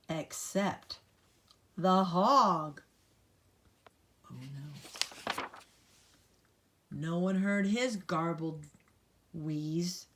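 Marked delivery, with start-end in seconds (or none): none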